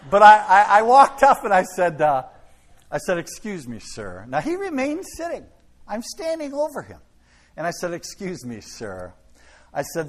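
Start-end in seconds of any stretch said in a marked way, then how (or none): 3.2-4.2 soft polite address. Retold or imitated. Politely addressing seated Nazi. 7.9-9.1 soft polite address. Retold or imitated.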